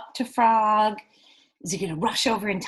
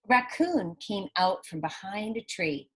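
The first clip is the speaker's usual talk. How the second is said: ordinary delivery, nothing marked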